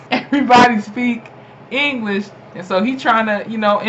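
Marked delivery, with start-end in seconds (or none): 0.1-2.3 laughing. Retold or imitated. Acting the redhead's rude line.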